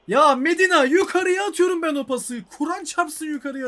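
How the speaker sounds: loud, high, outraged yell; furious shout. Real, not acted. Gamer yelling at the game over a misdirected pass, furious and loud. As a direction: shout at the game that betrayed you.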